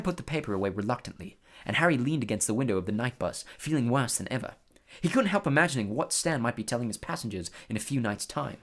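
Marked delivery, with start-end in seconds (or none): none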